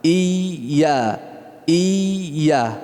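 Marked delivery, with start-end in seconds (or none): none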